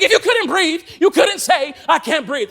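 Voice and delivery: a touch of a yelling drill instructor; barking instructor. Retold or imitated. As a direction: snap back at the whining recruit. Drill instructor rebukes recruit.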